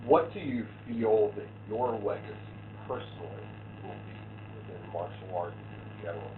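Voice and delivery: muffled and distant, like through a wall. Real, not acted. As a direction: sound muffled, as if behind a wall. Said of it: "it sounds like you're muffled".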